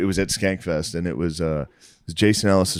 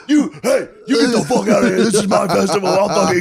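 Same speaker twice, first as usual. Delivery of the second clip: higher, louder mock outrage; mock angry comic; 11 semitones higher, 4 dB louder than usual. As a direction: play the comic yelling from stage. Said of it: "Lewis is like".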